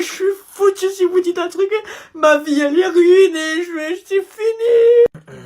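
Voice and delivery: voix qui se brise en pleurant, haute et forte; sanglots désespérés. Acted. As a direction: pleurer que sa vie est finie. (élève en larmes devant la prof, sanglots)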